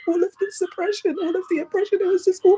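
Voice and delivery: high voice, close to crying; real emotion rising in pitch and volume; tearful. Real, not acted. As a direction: let it pour out through tears. Tearful memory of healing through music.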